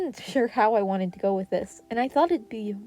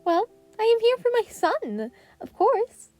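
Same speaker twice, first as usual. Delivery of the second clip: much higher, louder character voice; high-pitched cheerful mouse principal; chipper principal voice; 13 semitones higher, 5 dB louder than usual. Acted. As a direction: play the small, cheerful principal.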